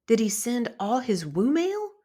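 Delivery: ordinary delivery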